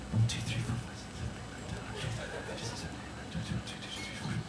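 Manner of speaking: very soft, whispered head count; barely audible quick tally; counting under breath. Real, not acted. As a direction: count the hands almost silently to yourself. Counting raised hands under breath.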